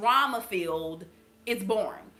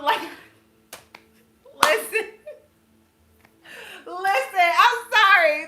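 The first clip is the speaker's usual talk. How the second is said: higher and louder, laughing as you apologize; laughing through it; 7 semitones higher, 8 dB louder than usual. Real, not acted. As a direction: crack up mid-rant. Laughs mid-rant, apologizes.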